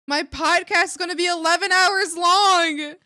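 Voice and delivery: loud, high burst of glee; excited. Real, not acted. Streamer thrilled about an eleven hour podcast, loud glee. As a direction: blurt it out, thrilled.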